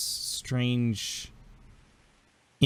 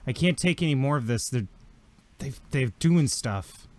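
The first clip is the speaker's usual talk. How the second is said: higher-pitched, mildly distressed plea; anguished child; 4 semitones higher, 5 dB louder than usual. Acted. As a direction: beg your father, halting at the end. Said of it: "a tremendous amount of anguish".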